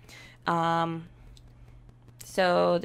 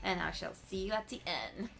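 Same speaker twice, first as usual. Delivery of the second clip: slightly higher, softer recorded voiceover; voiceover narration; 4 semitones higher, 4 dB quieter than usual. Acted. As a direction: talk the viewers through the results. Voiceover summary with music.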